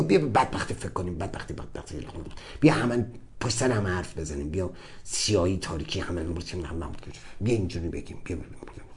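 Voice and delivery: very low, hushed, eerie whisper; sinister inner voice. Retold or imitated. The dark mind luring him toward misery, low and whispered. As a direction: lure him into darkness in a low hiss. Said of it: "همش میگفت".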